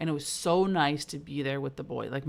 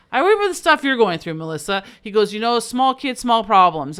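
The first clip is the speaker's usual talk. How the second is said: imitating brother; 6 semitones higher, 11 dB louder than usual. Brother quoted, lecturing.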